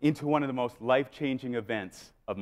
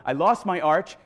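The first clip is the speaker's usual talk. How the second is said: usual speaking voice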